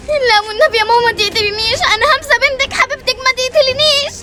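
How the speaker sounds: high, frightened begging for her life; terrified pleading. Acted. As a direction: plead with mama in panic. Daughter begging mother not to kill. (توسل مرعوب)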